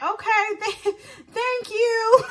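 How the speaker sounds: high, awkward giggly thank-you; laughing thanks. Retold or imitated. Woman thanking her crazy friend, laughing nervously. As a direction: re-enact stunned thanks breaking into laughter.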